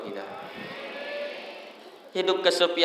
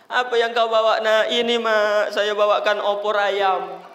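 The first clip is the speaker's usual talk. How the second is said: raised, hammed-up emotional voice; tearful homecoming exchange; 4 semitones higher, 4 dB louder than usual. Child presenting chicken stew to mother, acted tearful. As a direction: play a weepy mother and child.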